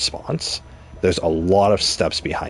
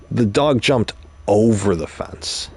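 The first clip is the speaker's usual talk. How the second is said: "over" set apart and punched; stress on OVER; 3 semitones higher than usual. Shown as a demonstration. Teacher showing listeners how emphasis changes meaning, stress on "over". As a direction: hold back briefly, then hit the word "over". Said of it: "If I emphasized a different word".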